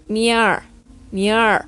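"miar" said MI-ar, for the word mere. Shown as a demonstration. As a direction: demonstrate "miar" with an I-A glide. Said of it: "y se pronuncia". ("miar" pronunciado mi-ar, con I A)

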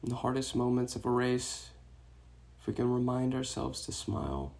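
soft meditation voice with long pauses; guided meditation. Real, not acted. Meditation teacher guiding runners to release tension, soft guided-meditation voice. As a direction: guide them gently into the breath, hushed and unhurried.

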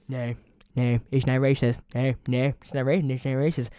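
Trump impression. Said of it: "that's the worst Trump impression".

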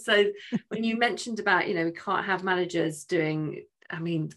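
ordinary talk, nothing marked

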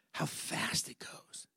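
slow, hushed whisper; wistful whisper. Retold or imitated. Daughter confiding to dad about growing up, slow imitated whisper. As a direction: whisper it slowly, voicing a tearful child's thought.

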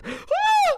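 sharp squealed "Ah!" way up high; retold girlfriend's high yelp of pain; high mock yelp. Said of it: "She goes".

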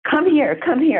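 high, urgent call; excited urging. Retold or imitated. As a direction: hurry her over, lightly imitated. Ruth urging her over.

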